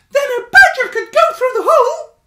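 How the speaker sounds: high, loud squeak with a laugh; bubbling with the discovery; growing excitement. Acted. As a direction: Stuart thinking it through aloud. Stuart working it out.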